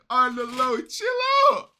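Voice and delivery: high, loud, wild hype shouts; hyped shouting. Real, not acted. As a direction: yell along like the crowd at a battle. Hyped reaction to rap bars.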